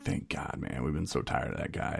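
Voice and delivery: hushed, grateful whisper; relieved whisper. Retold or imitated. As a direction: whisper the thanks after class. Classmates thanking him quietly.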